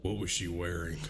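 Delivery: lightly mocked low, quiet question; Larry. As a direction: imitate him asking, low and hushed. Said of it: "Larry's only question".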